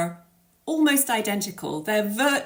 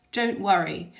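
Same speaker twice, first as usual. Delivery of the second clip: normal talking voice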